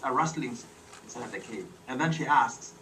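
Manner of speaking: plain reading voice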